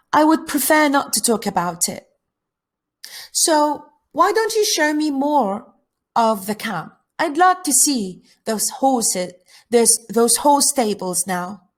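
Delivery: lightly voiced girl, politely deflecting; reserved camper girl.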